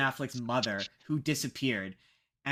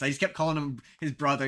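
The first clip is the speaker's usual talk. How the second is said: normal talking voice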